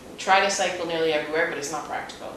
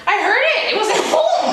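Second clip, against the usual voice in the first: excited lady; 6 dB louder than usual. Retold or imitated. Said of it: "She's like".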